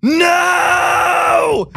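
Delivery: a shrieked no, very high and loud; mock anguished scream. Imitating the tripper's outcry, a high anguished no. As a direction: scream it in despair.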